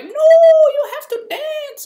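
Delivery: piercing, drawn-out falsetto yell; hammed-up high shriek, words stretched; loud girl. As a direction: imitate an overbearing girl at full volume. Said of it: "this girl who was loud".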